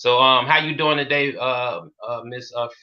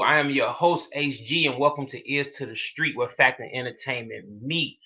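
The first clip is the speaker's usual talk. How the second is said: upbeat host opener, louder on the greeting; podcast host intro; 2 semitones higher, 5 dB louder than usual. Acted. Host welcoming listeners to Ears to the Street, upbeat announcer read. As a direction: hype up the listeners at the top of the show.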